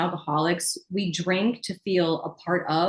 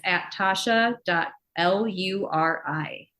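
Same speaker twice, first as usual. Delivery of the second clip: slow, spelled-out handle; spelling it out; slower than usual. Shown as a demonstration. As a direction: read each letter out slowly. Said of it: "Do you know what her, uh, I G handle is?".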